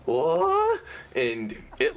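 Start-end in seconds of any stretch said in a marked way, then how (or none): 0.1-0.8 high squeaky surprised yelp; startled goblin yelp. Acted.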